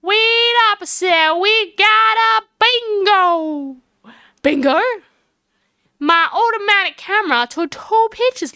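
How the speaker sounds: high, loud folksy Southern character; excitable country witness. Acted. Lotta hailing the investigators about her photos, excitable country voice. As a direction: play an eager small-town busybody.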